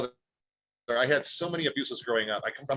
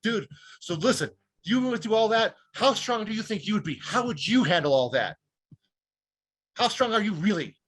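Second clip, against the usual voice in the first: retold in-your-face challenge, pitch raised; confrontational challenge; 5 semitones higher than usual. Survivor confronting the stranger who mocked him, challenging. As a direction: press him with pointed, emphatic questions. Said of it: "Just like that to this guy".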